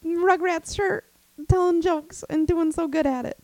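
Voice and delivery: high, cooing proud mom voice; hammed-up proud mom coo; stage mom. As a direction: gush like a doting stage mom. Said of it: "like a little stage mom".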